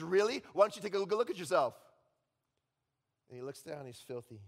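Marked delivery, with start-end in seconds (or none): 0.0-1.7 Satan taunting. Acted. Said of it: "And Satan says".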